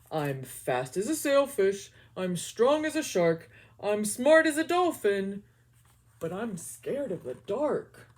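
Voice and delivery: a lightly voiced fish bragging, then shrinking; brag, then admit the fear; lightly voiced hero fish; boastful little fish. Mister Fish boasts then fears.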